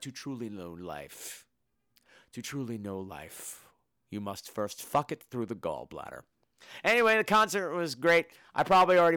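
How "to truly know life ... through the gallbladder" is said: low, hushed, slow pronouncement; mock-profound line dropped deep and quiet; solemn mock quote. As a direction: intone it gravely, dropping low and soft. Quotes a film's solemn lesson.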